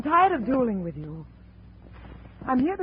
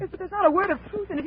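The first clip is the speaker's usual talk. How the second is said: agitated protest; 8 semitones higher, faster than usual. Acted. Widow insists Donovan is innocent.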